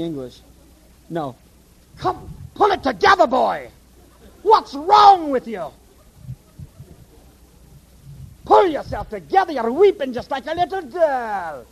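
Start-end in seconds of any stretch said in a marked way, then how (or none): 2.0-11.6 put-on a gruff Scottish elder telling a boy off; mock Scottish reprimand to a weeping lad. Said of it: "a Scottish drawl".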